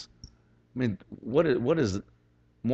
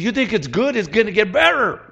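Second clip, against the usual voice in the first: high, rousing hype; excited promise; 6 semitones higher, 4 dB louder than usual. Retold or imitated. Preacher voicing the apostle raising hopes, excited. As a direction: raise the pitch and sell the good news.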